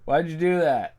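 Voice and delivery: high, laughing disbelief. Real, not acted. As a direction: ask it through laughter. Laughing at own flub.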